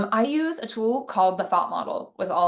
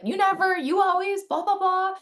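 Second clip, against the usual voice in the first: pitched way up, whiny accusing rant; mock heated complaint; 11 semitones higher than usual. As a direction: throw a blaming complaint at your partner, way higher. Complaining from a triggered state.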